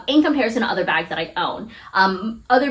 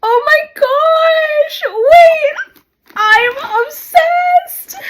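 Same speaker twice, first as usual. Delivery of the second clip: much higher and louder, slow, tearful; crying with joy; 5 dB louder, slower than usual. Real, not acted. Vlogger seeing her dream bag, crying with joy. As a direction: sob out happy disbelief. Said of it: "I'm getting emotional".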